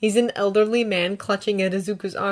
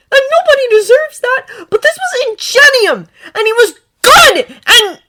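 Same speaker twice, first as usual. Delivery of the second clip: much higher, louder, sobbing through it; angry tears pouring out; crying anger; 14 dB louder than usual. A narrator's reading. Izuku crying in anger about Ingenium.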